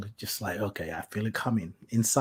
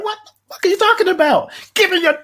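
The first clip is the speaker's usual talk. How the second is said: much higher, louder, fast exasperated yell; exasperated outburst; 9 dB louder, faster than usual. Retold or imitated. Caller yelling at call center agent, exasperated. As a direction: blast it like you've lost patience.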